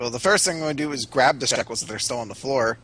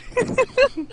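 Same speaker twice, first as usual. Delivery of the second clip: laughter. Real, not acted. Laughs at own ghost bit.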